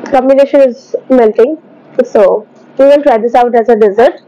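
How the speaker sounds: chewing while talking; speaking around a mouthful; mouth full of ice cream. Real, not acted. Seen 2: spoonful taken at -3.0, lips pressed and chewing, bowl held.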